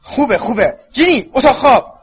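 high, loud, exasperated command; shouting. A narrator's reading. Mother gives in and sends her daughter to bed, shouting. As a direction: yell it, climbing to a peak. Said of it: "خانم ویزلی فریاد کشید".

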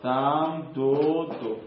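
spelled out slowly, syllable by syllable; slow dictation. Shown as a demonstration. Teacher dictating the root to students, slow and deliberate. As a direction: give the root slowly so students can write.

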